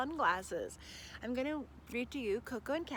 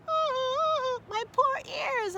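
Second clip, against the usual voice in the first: much higher, louder, slow wailing cry; crying howl; 8 dB louder, much slower than usual. Acted. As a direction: wail as the sirens hurt. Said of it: "howled Cavendish".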